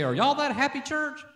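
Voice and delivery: high-pitched imitation of a nosy questioner; questioning. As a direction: lift it high, like quoting a sceptic's question. Voicing people questioning the church.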